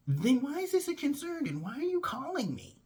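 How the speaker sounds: high, exasperated questioning; exasperated challenge. Retold or imitated. Employee challenging the boss who called, exasperated. Acted quarrel on the phone.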